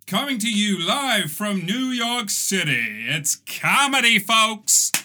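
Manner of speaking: high, building announcer hype ending in a shout; hyped-up host intro. Pretend. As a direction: sell the host like a cheesy game show. Restarts the intro bigger.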